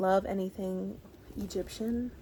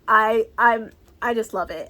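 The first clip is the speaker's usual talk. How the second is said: ordinary talk, nothing marked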